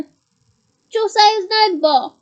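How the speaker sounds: loud, slow, alarmed question; horrified he said the name. A narrator's reading. Startled passenger scolding Harry.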